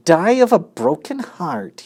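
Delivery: high mocking echo of the plea; mimicked. Rat mocks the pig's plea, high scornful mimic. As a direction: throw his words back scornfully. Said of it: "he mimicked".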